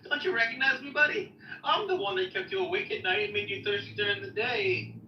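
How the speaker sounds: high, lively mock character voice; hammed-up talking book; playful Quran voice. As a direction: voice the Quran as a chummy old friend. Quran voiced as pale man.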